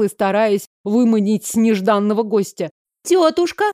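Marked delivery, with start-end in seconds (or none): 3.0-3.7 high-pitched, questioning call; surprised call. A narrator's reading. Elf girl arriving home and calling aunt, surprised high voice. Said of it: "удивлённый голос Лори".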